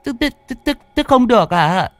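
put-on high, stammering old woman's voice. A narrator's reading. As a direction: stutter in alarm as an old mother. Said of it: "Bà ú ớ". (bà già ú ớ hốt hoảng)

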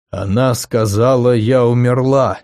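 ordinary delivery

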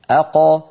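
"أق" stopped deep at the back of the tongue; deep qaf. Shown as a demonstration. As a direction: say "أق" with a firm back q. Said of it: "جربوا هكذا".

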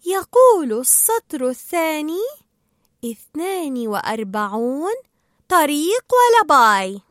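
slow, drawn-out deciphering; reading aloud slowly. Acted. Dory deciphers the mask's writing, slow reading. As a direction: read it out slowly, stretching each word.

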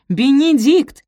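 loud, high rising call; urgent exclamation. A narrator's reading. Sophie trying to wake Benedict, insistent cry. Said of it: "воскликнула она".

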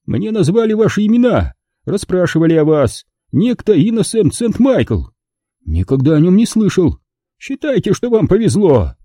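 high-pitched agitated dialogue; agitated characters. A narrator's reading. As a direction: voice a startled exchange above the narration. Voices agitated figures in dialogue.

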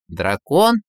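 astonished questioning echo, rising at the end; surprised. A narrator's reading. Tsar doubts Ivan's story of the culprit, surprised. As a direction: repeat it as a puzzled question. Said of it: "удивился царь".

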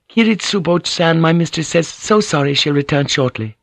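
high-pitched, courteous servant voice; lifted, deferential female voice; polite maid. Acted. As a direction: play a maid respectfully passing on a message. Maid delivers a message.

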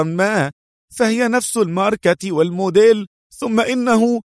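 high-pitched, stammering start, anxious excuses; nervous pleading. A narrator's reading. Cornered man explaining himself to angry Adham, nervously pleading. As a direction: plead your case in a high, worried voice.

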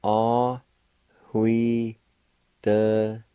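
Ah, hui, de said as separate syllables with a faint breathy H before hui. Shown as a demonstration. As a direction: break the word into Ah, hui, de and breathe the H. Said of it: "a faint H sound or an aspirated sound, like this".

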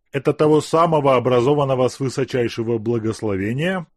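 wry sneer. Acted. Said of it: "Криво усмехнулся Азеф".

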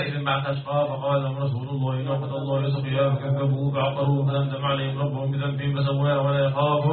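melodic chanted Quran recitation. Acted. Teacher recites the verses for the class to continue, chanted. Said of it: "أكمل هكذا".

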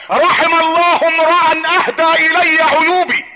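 high, drawn-out pulpit proclamation; declaimed inscription. Acted. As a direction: stretch every word slowly on a high pitch. Proclaims the inscription on Omar's door. (إلقاء منبري عالٍ ممدود)

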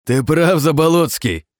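high, loud, crazed laughing cry; mad laughing shout. A narrator's reading. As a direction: cackle it like a madman. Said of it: "рассмеялся громко и безумно я".

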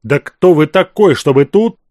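loud, hammed-up indignant flare; theatrical outburst. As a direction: flare up in staged outrage. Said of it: "Театрально завёлся Азеф".

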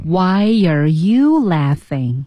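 非常慢，"you" 重读后降调; 拖长语速，压在 "you" 上. Shown as a demonstration. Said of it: "跟着老师把这种感觉读出来". (老师领读，放慢并重读 "you")

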